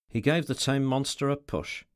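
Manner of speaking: ordinary delivery, nothing marked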